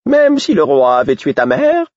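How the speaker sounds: spring the question on him sharply; high, loud jester provocation; the fool. Acted. Fool's shocking question.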